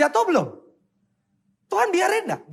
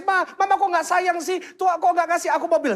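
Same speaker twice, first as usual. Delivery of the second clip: higher-pitched mock pleading kid; imitated tearful child; 11 semitones higher than usual. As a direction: lightly whine like a child begging. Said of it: "dia terus dia nangis".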